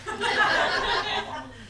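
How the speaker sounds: long, high peal of laughter. Acted. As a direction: laugh helplessly at your mate. Laughing after getting his hand.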